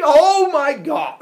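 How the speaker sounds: very loud, high, drawn-out exclamation; hammed-up horrified yell; mock revulsion. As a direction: recoil in horror mid-speech. Revolted at sight of brothers.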